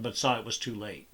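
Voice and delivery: ordinary delivery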